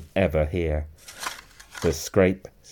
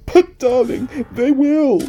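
high, loud tearful protest; sobbing; 9 dB louder than usual. A narrator's reading. Missus Fox despairing to her husband, high sobbing. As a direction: plead through sobs in a high voice. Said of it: "sobbed Missus Fox".

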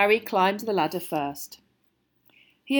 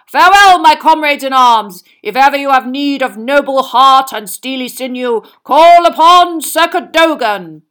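loud, higher knightly proclamation; stagey fake archaic declaiming; 6 semitones higher, 16 dB louder than usual. A narrator's reading. Knight's grand parting declamation.

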